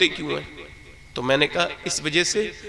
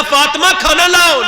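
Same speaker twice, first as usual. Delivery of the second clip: calling out; 9 semitones higher, 7 dB louder than usual. Retold or imitated. Husband calling third time.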